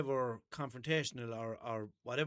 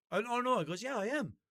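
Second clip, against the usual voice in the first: higher, louder indignant comeback; defiant retort; 9 semitones higher, 4 dB louder than usual. Retold or imitated. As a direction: push back hard at the rude worker. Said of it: "his attitude".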